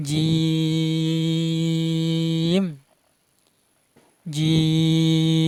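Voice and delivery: "জিম" with a very long, level held vowel; four-alif elongation. Shown as a demonstration. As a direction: stretch the vowel of "জিম" steadily before closing on m. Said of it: "চার আলিফ পরিমাণ টেনে পড়বেন". ("জিম" চার আলিফ টেনে)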